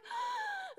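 imitated high-pitched gasp of a crying child; mock sob intake; sobbing child's gasp. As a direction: gasp like a kid crying too hard to talk. Imitating child too upset to talk.

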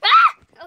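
short, high, loud scream; sudden high-pitched shriek. Real, not acted. Player startles the other player, a sudden scream. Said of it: "why did you scream".

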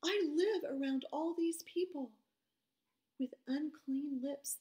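dawning dread in Isaiah's voice; Isaiah's alarm. Retold or imitated. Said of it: "And then he thought".

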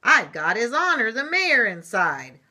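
loud, self-important shout; yelling limousine. A narrator's reading. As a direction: announce it at full volume, full of importance. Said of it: "yelled a limousine".

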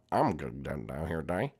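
put-on low, soft childish Jeffy voice. Jeffy answers his parent, low sulky kid voice. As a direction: mumble it like a stubborn kid.

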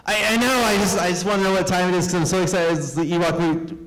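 put-on high, fast, breathless boy; boy defending himself to his mom, fast and high; excited kid. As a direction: rush it out like an overexcited child.

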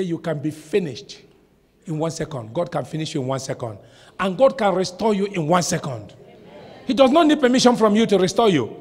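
ordinary talk, nothing marked

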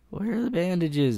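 ordinary delivery

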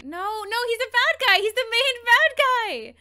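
high, loud urgent warning at the screen; alarmed warning. Real, not acted. Viewer yelling a warning at the screen, alarmed. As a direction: shout a warning to a character who can't hear.

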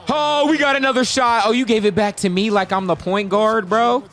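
high, loud run-on outburst; excited exclaiming. Real, not acted. Gamer handed the ball like a point guard, excited exclaiming. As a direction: yell in disbelief, pitch staying up.

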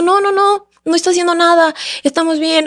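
high-pitched imitated "all is fine" protest; hurried reassurance. Woman retelling how she reassured passers-by, anxious-to-placate reassurance.

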